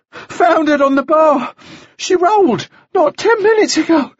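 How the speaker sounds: put-on high, breathless boy's report; ragged breath. A narrator's reading. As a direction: pant out the disaster in bursts. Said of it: "He drew a ragged breath".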